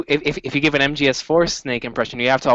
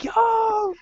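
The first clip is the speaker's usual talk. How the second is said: excited shout. Retold or imitated. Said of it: "I was like".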